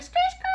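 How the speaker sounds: very high skirt skirt; high squealing car noise; tire screech imitation. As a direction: screech like tires. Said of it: "pulling up like".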